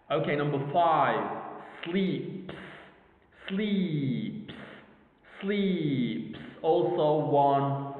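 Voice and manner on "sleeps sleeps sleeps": "sleeps" drawn out on a long ee; one-syllable demonstration. Shown as a demonstration. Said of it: "okay, one syllable".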